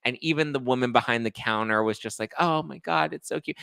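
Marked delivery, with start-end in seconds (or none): 2.4-3.6 high, softened imitation of cooing; mock gushing. Store clerk admiring an engagement ring, mock gushing. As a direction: coo over it in a put-on high voice.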